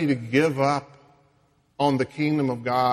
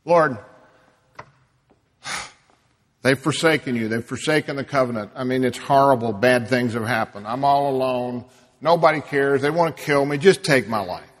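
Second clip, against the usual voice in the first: loud, pleading cry of despair; anguished lament; 8 dB louder than usual. Acted. Elijah crying out to God, loud and desperate. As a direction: throw it out like a man at the end.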